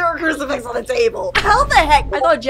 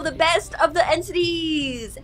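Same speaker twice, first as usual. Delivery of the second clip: ordinary talk, nothing marked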